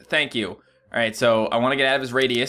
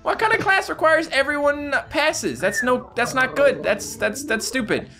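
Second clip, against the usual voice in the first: high, outraged protest at the grade; indignant complaint; 11 semitones higher than usual. Real, not acted. Gamer protesting a failed class exam, high and outraged. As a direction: argue the score is unfair, pitched way up.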